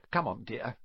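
tender and hushed; gently coaxing. A narrator's reading. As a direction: gently coax a hurt child along. Teacher comforts hurt boy.

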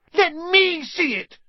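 high slow loud demand. A narrator's reading. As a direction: demand it like a spoiled boy. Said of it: "demanded Dudley".

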